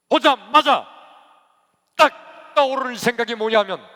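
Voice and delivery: loud, high, impassioned preacher; full-force preaching. Real, not acted. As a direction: thunder it out in bursts with pauses.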